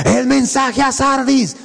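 high, slow, echoing proclamation; booming echo. Retold or imitated. Preacher imitates God's voice echoing among the mountains, slow high call. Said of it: "Así era la voz".

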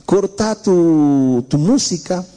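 ordinary talk, nothing marked

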